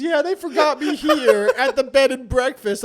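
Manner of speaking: put-on loud, high, drawn-out child's complaint; upset niece imitation. Niece telling uncle she was left behind, hammed-up complaint. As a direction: mimic a forgotten child, slow and loud. Niece's dramatic reveal retold.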